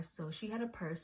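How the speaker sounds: normal talking voice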